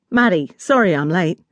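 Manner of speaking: put-on broad Australian accent, friendly apology; thick Australian accent. Australian man apologising to Maddie, thick Australian accent. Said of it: "in his thick Australian accent".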